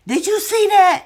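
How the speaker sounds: high, loud excited question; a kid pointing something out, mock excited; imitating friend. Son's friend spotting the costumed uncle, high excited voice.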